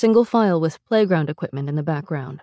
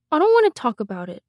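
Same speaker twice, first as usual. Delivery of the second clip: put-on high, quick child voice; rushed childish refusal, pitched up; evasive little boy; 7 semitones higher, faster than usual. A narrator's reading. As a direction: shut the question down like a small child. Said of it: "he'd always answer".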